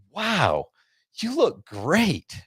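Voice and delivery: high, slow, drawn-out imitated gush; imitating the dermatologist praising the wife, high and slow; gushing doctor. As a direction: mimic the doctor greeting the wife. Retold doctor complimenting wife.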